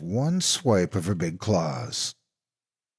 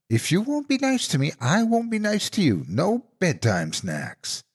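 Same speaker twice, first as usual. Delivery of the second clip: sweet voice turning menacing; fake auntie threat; 11 semitones higher than usual. A narrator's reading. Monster in auntie disguise threatening no snacks.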